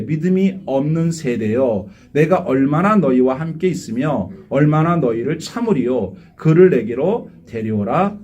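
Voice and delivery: measured biblical reproach; solemn scripture reading. Acted. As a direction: read it slowly like a grave scolding. Reads Jesus' rebuke.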